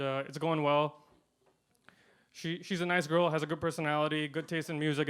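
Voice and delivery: usual speaking voice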